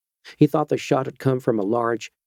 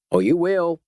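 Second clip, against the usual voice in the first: higher Georgia-twanged vigilante, sly and unhurried; a lightly voiced Georgia backwoodsman toying with the stranger; 7 semitones higher than usual. A narrator's reading. Vigilante warns the doctor off in a Georgia twang. Georgia man holds his voice.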